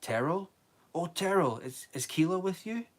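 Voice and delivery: high, hopeful calling out; mother calling desperately for her child; shrill with hope. Acted. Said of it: "shrill with hope".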